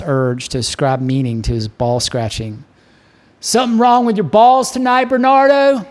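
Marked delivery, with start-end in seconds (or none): 3.4-5.9 high, loud jeering yell across the bar; yelled taunt. Acted. Drunk Jack yells across the bar at Van Dillingham, loud mocking yell. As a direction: shout the insult so the whole room hears. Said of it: "I yelled at him".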